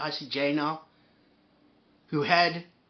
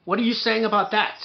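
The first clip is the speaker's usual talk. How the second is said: pressed louder and higher the second time; louder repeat; 4 semitones higher, 5 dB louder than usual. Real, not acted.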